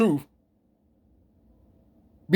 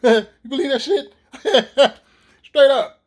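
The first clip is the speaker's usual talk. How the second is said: laughing; 4 semitones higher, slower than usual. Real, not acted. Laughing at his own story.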